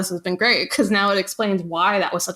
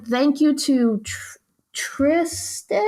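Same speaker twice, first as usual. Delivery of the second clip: halting, higher-pitched read, unsure of the name; puzzled reading; 5 semitones higher, slower than usual. Pretend. Host reading a producer thank-you note, mock puzzled.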